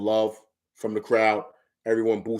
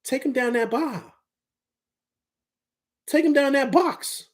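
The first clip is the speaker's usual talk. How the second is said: high-pitched, insistent urging; impassioned coaching; 9 semitones higher than usual. Acted. Analyst urging RJ to punish the smaller defender, high-pitched impassioned coaching. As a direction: push each instruction up high, like coaching from the sideline.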